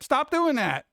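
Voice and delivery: high squeaky squirrel scolding. Acted. Squirrel telling himself off, high squeaky voice.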